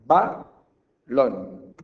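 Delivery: BA, LON as two loud separate bursts; syllable beats. Shown as a demonstration. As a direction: punch out ba, pause, then lon. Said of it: "por golpes de voz".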